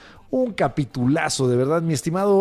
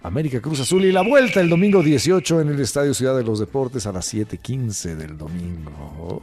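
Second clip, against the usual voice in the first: ordinary delivery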